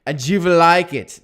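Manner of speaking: slow, loud mock German accent with a v for will; a hammed-up German Bond villain accent, menacing and slow; mock German villain growling out every word. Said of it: "sounds like a Bond villain".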